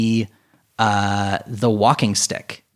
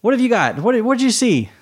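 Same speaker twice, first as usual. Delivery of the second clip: much higher, louder, urgent questions; frantic high voice; 13 semitones higher, 3 dB louder, much faster than usual. Acted. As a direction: press him for answers, rattled. Manning anxiously demanding over radio.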